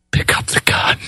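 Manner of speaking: whispered cold gunslinger threat; menacing whisper. Retold or imitated. Jack Palance whispers to the sheep herder, menacing. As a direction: hiss it like a gunfighter daring him. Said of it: "You're like Jack Palance".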